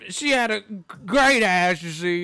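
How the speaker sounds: mocking the promoter's random remark; goofy Bob Arum. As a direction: savor the words like a leering old man. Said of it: "Bob Aaron be like".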